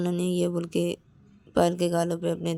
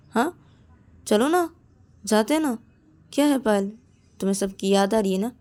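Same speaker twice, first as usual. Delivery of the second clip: higher, louder pleading; coaxing friend; 5 semitones higher, 6 dB louder than usual. Acted. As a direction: talk her into going. Ananya coaxes higher.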